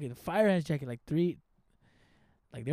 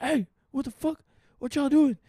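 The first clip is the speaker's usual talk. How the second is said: imitating a raised, higher challenge; retold calling out; 6 semitones higher, 4 dB louder than usual. Man retelling how he confronted fools in his car, calling out. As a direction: call out as if confronting intruders, retold.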